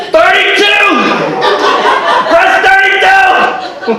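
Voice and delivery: громкий высокий крик номера этажа; выкрикни номер как чудак в лифте; shouted floor number. Retold or imitated. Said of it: "Like this".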